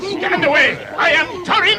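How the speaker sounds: loud, high defiant shout; shouted challenge to the trolls; battle cry. Acted. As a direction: roar it as you charge in. Dwarf charges into the fight.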